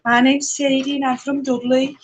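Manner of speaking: high, sing-song mock Dudley accent. Shown as a demonstration. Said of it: "Dudley's just as bad".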